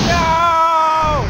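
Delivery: high loud cry of loss; anguished shout. Real, not acted. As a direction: scream it out in despair. Unit destroyed, shouting.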